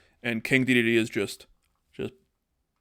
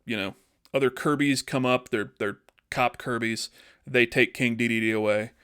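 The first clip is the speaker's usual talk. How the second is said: ordinary delivery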